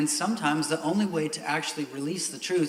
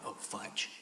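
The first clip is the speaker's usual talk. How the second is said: muttered minced oath; 13 dB quieter than usual. Retold or imitated. Mild minced oath acted out.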